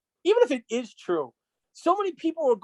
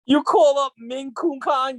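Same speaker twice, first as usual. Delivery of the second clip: normal talking voice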